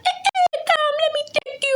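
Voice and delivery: very high mimicked call; squeaky mock calling-over; high-pitched coaxing. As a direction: squeal the invitation, imitating. Acted driver calling her over.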